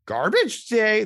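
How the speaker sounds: hammed-up movie-scene exclamation; movie quote. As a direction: belt it out like a famous film quote. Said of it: "There's a, a whole movie scene".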